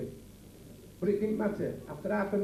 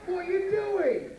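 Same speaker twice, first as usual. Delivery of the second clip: exasperated; 10 semitones higher, much slower than usual. Acted. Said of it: "comic repeats the question".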